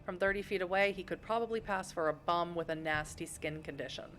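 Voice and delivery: ordinary talk, nothing marked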